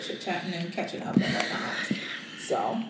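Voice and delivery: soft whispered trail-off; whispering. Real, not acted. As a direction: let it fade to a whisper.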